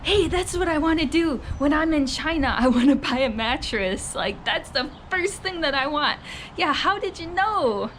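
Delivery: high-pitched sarcastic gushing; mock excitement. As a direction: play a customer sarcastically thrilled by the sales pitch. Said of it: "trying to get you excited".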